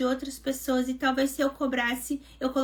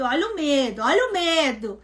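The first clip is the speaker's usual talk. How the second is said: teasing; 4 dB louder than usual. Retold or imitated. Mocking her own fear.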